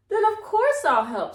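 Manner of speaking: high, loud and eager; eager agreement. A narrator's reading. Cat happily agreeing to help the old woman, eager. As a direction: leap in gladly to help. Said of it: "said the cat".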